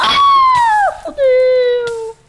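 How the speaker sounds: sudden shrieking panic; panicked scream. Real, not acted. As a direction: scream in real fright. Streamer panics at game jump-scare.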